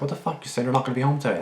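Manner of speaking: retelling yelling at her sister who came home; angry yelling. Retold or imitated. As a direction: snap it at her like you're furious. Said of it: "I was so mad".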